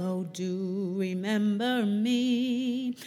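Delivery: usual speaking voice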